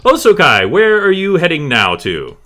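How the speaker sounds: high, very loud announcer call; loud, high-pitched showman cue; game master scene cut. Acted. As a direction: belt out the scene change, then hand over. Game master cuts to next player.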